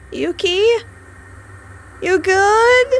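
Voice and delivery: high, rising frightened call; scared calling. Acted. Narrator calling to her motionless friend, high and scared. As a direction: call out hoping she answers.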